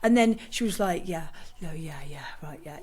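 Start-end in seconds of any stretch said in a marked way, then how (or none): 1.1-2.8 imitating a deflated athlete, much softer, nearly whispered; dazed murmur. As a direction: mumble it like she's gone blank. Imitating heartbroken athlete's reply.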